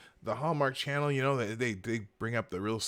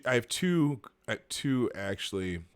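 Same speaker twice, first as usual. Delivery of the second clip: normal talking voice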